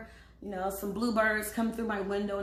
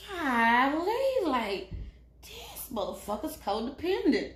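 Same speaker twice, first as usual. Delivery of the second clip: creative self; 5 semitones higher, 5 dB louder, slower than usual. Retold or imitated. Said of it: "the creative me is like".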